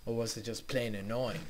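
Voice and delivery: ordinary talk, nothing marked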